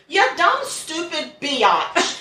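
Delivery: high laughing jeer at her; amused mock outrage; laughing insult. Real, not acted. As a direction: shriek it then laugh through the insult. Mocking the killer's note.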